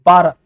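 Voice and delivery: भारत punched loud as बारत; English reading. Shown as a demonstration. Teacher imitating an Englishman's भारत. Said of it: "तो वो इसको पढ़ेगा".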